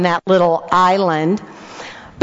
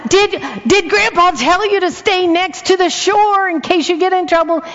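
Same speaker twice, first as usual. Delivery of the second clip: high, raised worried call; anxious call; 9 semitones higher than usual. Retold or imitated. Grandma calling after grandkids on the boat, high anxious call. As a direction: shout the safety question after them.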